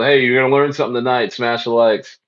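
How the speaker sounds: usual speaking voice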